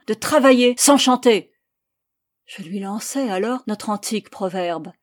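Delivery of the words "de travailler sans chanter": loud, forceful guard's command; hammered out. A narrator's reading. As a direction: hammer each word of the order. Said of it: "martela le gardien". (martelé d'une voix forte)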